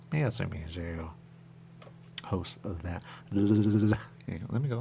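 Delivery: ordinary talk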